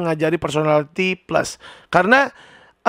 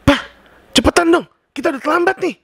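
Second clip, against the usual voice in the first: impatient wife; 9 semitones higher, faster than usual. Acted. Wife hurrying slow husband.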